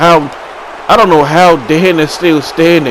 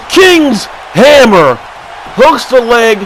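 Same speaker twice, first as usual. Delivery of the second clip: roaring finisher call; hyped move call; 5 semitones higher, slower than usual. Real, not acted. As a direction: drag out the move name like a big reveal.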